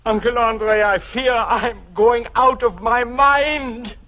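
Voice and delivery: loud, slow and anguished; desperate. Acted. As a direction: plead in fear of madness. Desperate confession to uncle.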